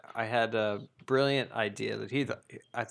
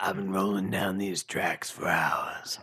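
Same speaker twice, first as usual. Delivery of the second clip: [name] voice; 4 semitones lower, slower than usual. Pretend. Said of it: "[name] is playing Thomas".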